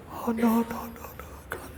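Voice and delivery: high start fading to whisper; mock despairing whimper. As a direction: whimper weakly in hammed-up dismay. Man despairs at the answer.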